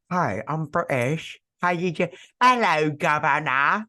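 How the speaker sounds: hammed-up British greeting in a British accent; chirpy mock British accent. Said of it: "every British person you saw was just like".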